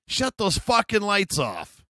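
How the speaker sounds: high, drawn-out yell across the water; slow, high-pitched retold holler; mock screaming. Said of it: "she's just screaming".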